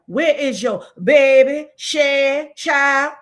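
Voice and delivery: lightly hammed-up Louisiana talk; Louisiana accent. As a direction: lay on a Louisiana voice for where is your baby, share, child. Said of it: "if you're really doing Louisiana".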